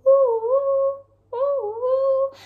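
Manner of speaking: high, loud wailing wind; howling wind. Acted. Reading to children, loud high howling wind. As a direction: howl like a snowstorm.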